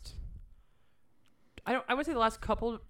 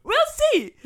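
high, loud teasing repeat; mocking; 10 dB louder than usual. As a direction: tease it in a squeaky voice. Mocking the astrologer's line.

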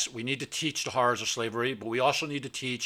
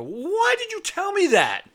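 very high, slow, strained cry; outraged cry; 5 dB louder, slower than usual. Retold or imitated. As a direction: wail it at the friend in disbelief. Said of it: "I go".

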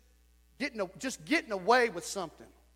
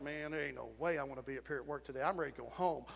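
grumbling captain; 7 semitones lower, 9 dB quieter, faster than usual. Retold or imitated. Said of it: "walked in there like".

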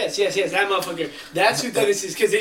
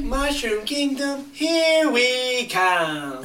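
Chris Pratt Mario; 7 semitones higher, much slower than usual. Retold or imitated. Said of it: "Did you ever see the trailer where it's like".

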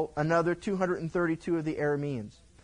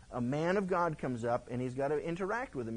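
usual speaking voice

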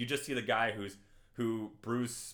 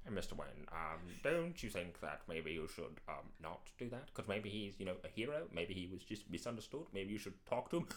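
soft, hesitant butler voice; Alfred; 4 semitones lower, 10 dB quieter than usual. Acted. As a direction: reason timidly with the master. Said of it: "all of Alfred and Batman's scenes".

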